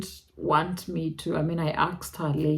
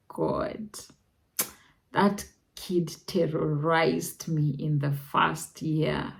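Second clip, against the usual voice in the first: usual speaking voice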